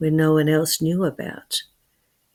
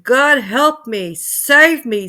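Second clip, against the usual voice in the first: higher, loud, slow cry for help; scream; 6 semitones higher, 7 dB louder, slower than usual. Acted. Joe pulled up again, screaming for rescue. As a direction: beg desperately at full voice. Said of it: "Joe screamed for all he was worth".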